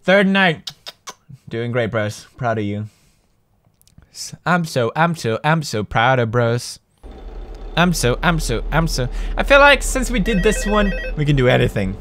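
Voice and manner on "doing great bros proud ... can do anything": softer, heartfelt praise; gentle proud words to the bros; soft sincere. Real, not acted. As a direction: speak quietly like you truly mean it.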